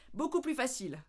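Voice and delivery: ordinary delivery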